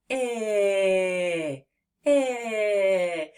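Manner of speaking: I held long and drawn out; long stretched I. Shown as a demonstration. Teacher shows the short I can be stretched long. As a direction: stretch the I as long as the air lasts. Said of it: "let's try to say it long".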